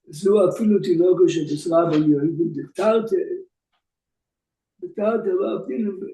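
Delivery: fast, running Talmud study recitation; rapid text reading. Acted. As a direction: rattle off the Hebrew text quickly. Reads Gemara and Rashi aloud.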